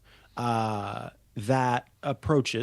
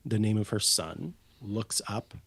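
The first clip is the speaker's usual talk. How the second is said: ordinary talk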